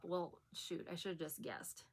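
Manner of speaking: ordinary talk, nothing marked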